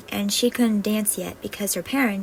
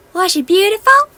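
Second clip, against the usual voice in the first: high, louder dreamy question; fairy eager for gossip; 11 semitones higher, 12 dB louder than usual. Acted. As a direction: gush with curiosity. Fairy gushes.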